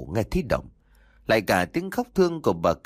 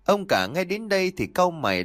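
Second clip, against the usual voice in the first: ordinary delivery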